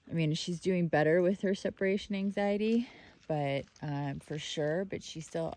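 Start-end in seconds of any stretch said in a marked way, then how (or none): none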